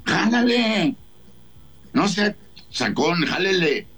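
high, taunting dare; defiant taunt. Retold or imitated. Guest retells taunting robber to shoot, high. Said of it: "Le digo".

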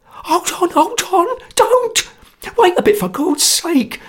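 very high, panicked shouts; terrified cry. Acted. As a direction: scream stop in terror. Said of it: "cried Socher in a sweat of terror".

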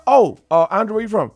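high, loud mock curiosity; imitated Toronto acquaintance. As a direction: act out a nosy question. Acting out Toronto people asking.

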